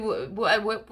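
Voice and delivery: ordinary talk, nothing marked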